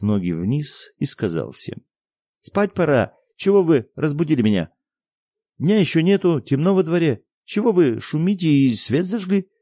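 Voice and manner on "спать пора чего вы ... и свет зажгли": put-on high, loud boyish scolding; boy woken at night scolding the grown-ups; cross boy. A narrator's reading. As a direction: complain like an annoyed little boy.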